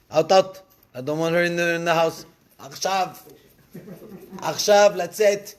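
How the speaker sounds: high, loud insistence; wife ordering her husband to throw Hagar out, angrily; angry demand. Acted. Sarah demands Hagar leave.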